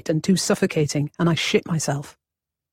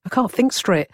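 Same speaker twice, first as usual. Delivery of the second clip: usual speaking voice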